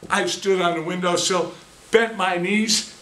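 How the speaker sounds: ordinary talk, nothing marked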